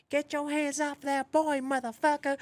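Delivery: cross-dressers yelling at his friend, high shriek. Retold or imitated. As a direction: shriek it at him. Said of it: "cross-dressers".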